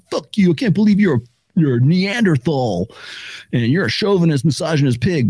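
mock the hater's rage; mock hater rant. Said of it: "They'll slide into my D Ms".

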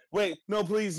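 high mock pleading voice; high, whiny plea from a captive iPad; pleading Stan. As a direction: squeak out a begging plea.

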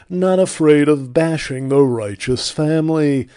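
loud, put-on ad-announcer voice for the tagline; Ron Reagan Junior. Host mocking a celebrity's ad tagline, loud put-on announcer voice. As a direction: boom the slogan like a smug atheism ad. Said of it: "Ron Reagan Junior with an early appearance".

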